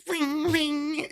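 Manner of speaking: high sing-song ring ring; playful doorbell ding-dong; doorbell imitation. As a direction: mimic the doorbell chime up high.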